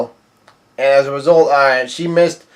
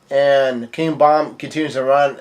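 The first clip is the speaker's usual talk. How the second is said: usual speaking voice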